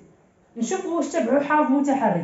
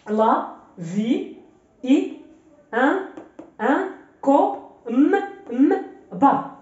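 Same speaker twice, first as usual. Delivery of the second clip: each syllable alone, with pauses between; slower than usual. Shown as a demonstration. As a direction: sound out slowly and firmly for beginners. Teacher sounds out syllables one by one. (قراءة متقطعة مقطع بمقطع)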